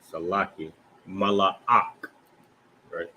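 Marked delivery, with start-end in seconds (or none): 1.1-2.1 Malak drawn out as ma-la-ak; separated syllables. Shown as a demonstration. Teacher showing how you pronounce it, Malak in separated syllables. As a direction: break Malak before its final ak. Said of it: "That's how you pronounce it".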